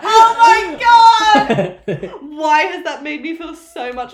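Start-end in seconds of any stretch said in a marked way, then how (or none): 0.0-2.1 loud, high, helpless laughing shriek; cracking up and screaming it out; real laughing scream. Real, not acted. Shrieking laugh at friend's jab.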